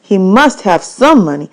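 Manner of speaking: ordinary delivery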